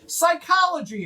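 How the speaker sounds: high, proud one-word blurt; high eager gnome. Acted. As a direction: pipe it up proudly. Fourth gnome in corner.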